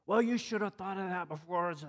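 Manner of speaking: put-on indignant comeback; scolding retort. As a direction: imitate an annoyed young man snapping back. Young man's retort in retold quarrel.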